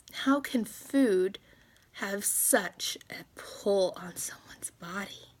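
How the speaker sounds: slow, fading into a whisper; fighting emotion, let it drop to a whisper; holding back tears. Real, not acted. Venting about food's pull, slow choked whisper. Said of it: "I'm trying to control this".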